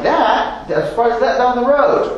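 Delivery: mock the new car with scorn; mocking scoffer. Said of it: "Scoffing, mocking".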